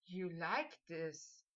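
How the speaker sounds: slow, low, hushed question; drawn-out soft murmur of awe; breathed in awe. A narrator's reading. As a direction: breathe it out softly, wonderstruck. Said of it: "Harry breathed out in awe".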